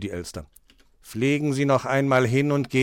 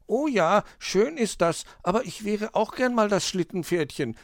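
put-on high-pitched childlike hedgehog; little hedgehog; 8 semitones higher than usual. Hedgehog asks to play sledge horse, high child voice. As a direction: play a small hedgehog wishing for a turn, high.

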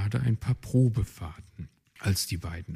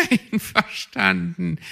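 laughing agreement; 11 semitones higher, 7 dB louder than usual. Acted. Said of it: "lachte Luzi".

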